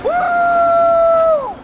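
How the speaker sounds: loud high whoop; excited woo. Real, not acted. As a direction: let out a thrilled shout. Excited cheer while riding.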